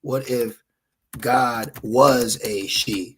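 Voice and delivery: very slow, each word drawn out; slow, weighty question; slow deliberate. Shown as a demonstration. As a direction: lay it out slowly for emphasis. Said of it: "let me speak like this".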